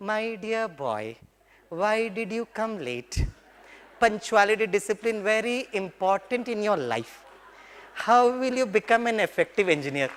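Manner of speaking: slow gentle reproach, drawn out; lovingly. Retold or imitated. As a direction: say it like a loving father to a late student. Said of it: "अभी बड़े प्यार से बोलता हूँ".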